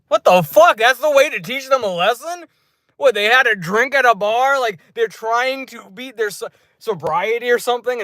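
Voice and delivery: high-pitched disbelieving protest; worked-up, high incredulous rant; incredulous outrage. Retold or imitated. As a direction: question it in exasperated disbelief, voice way up. Mock outrage at Jigsaw's lesson.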